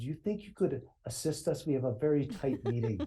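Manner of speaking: mock Dutch accent with halting English; broken Dutch accent. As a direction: Dutch accent, asking the officer for help. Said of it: "drops into his very broken English with a Dutch accent".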